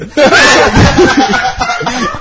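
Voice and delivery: a big bright burst of laughter; loud laugh. Real, not acted. Telling that his dad laughed, bursting out laughing. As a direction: crack up loudly.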